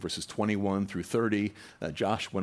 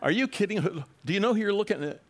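exasperated rebuke; 11 semitones higher than usual. Retold or imitated. Jesus mock-scolding his bickering disciples, high and exasperated. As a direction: scold the squabbling disciples in disbelief. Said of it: "I never read Jesus saying".

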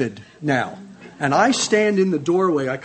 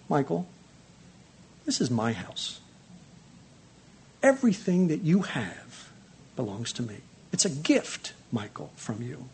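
quiet still voice; 2 semitones lower, 5 dB quieter than usual. Retold or imitated. God speaking to Michael in a quiet, still voice. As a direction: speak it softly, like God whispering to the heart. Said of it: "he spoke in a kind of this quiet, still voice".

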